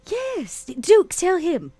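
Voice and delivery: normal talking voice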